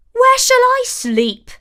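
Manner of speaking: high, loud squeaky dwarf complaint; a dwarf fussing over his bed; whiny dwarf. A narrator's reading. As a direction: wail about a lost bed. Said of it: "asked a third".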